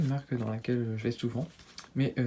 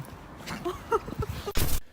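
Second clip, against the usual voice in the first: high laugh. Real, not acted. Laughing at her own joke.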